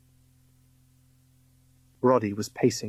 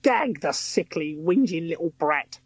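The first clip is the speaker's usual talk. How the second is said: high, slowed villainess voice; sneering villain; 5 semitones higher than usual. A narrator's reading. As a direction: spit the order with contempt. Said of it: "Missus Croker sneered".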